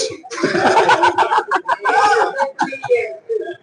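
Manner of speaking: long, high-pitched laughing fit; high, drawn-out laughter at your own joke; big laugh. Real, not acted.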